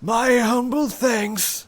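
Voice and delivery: put-on high, slow frail old voice; frail old man. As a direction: thank him feebly and slowly. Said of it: "says the frail old man".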